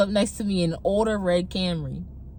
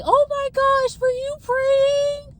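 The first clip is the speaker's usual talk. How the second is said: put-on much higher, slow, touched young woman's voice; surprised girl; 5 dB louder, slower than usual. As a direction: gush like she's moved you'd pray. Other girl's surprised reply.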